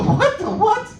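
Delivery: high, sharp squeal of disbelief; shocked disbelief. Retold or imitated. Comic hearing his girlfriend is his cousin, shocked squeal. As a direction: shriek it like you just heard the worst news. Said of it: "I go".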